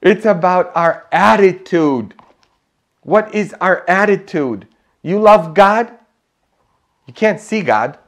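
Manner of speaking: impassioned sermon delivery; forceful preaching. Real, not acted. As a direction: drive the challenge home to the congregation. Preaches at raised pitch and volume.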